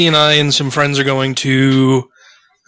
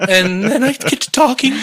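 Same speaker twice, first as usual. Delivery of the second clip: much higher mock puberty voice; Bobby Brady; 9 semitones higher than usual. Host joking along about getting to talking, high pubescent voice. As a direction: play a boy whose voice is changing. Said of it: "I sometimes feel like Bobby Brady".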